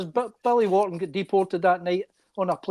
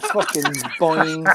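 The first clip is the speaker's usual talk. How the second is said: slow, odd put-on boing; a slowed, strange-voiced boing; mock boing; 2 semitones lower, slower than usual. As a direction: draw it out like a cartoon sound. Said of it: "I just went".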